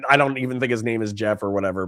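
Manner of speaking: normal talking voice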